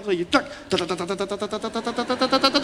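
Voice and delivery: soft clicks of a rotary dial; quiet tak tak of a rotary phone; dial clicking. Retold or imitated. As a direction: tick it off like a dial spinning back. Imitating dial turning back.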